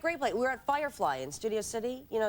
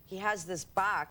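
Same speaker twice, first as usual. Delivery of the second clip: ordinary talk, nothing marked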